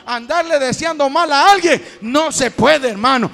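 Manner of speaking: loud, rising preacher's climax; preaching at full force. Acted. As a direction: drive it home to the congregation.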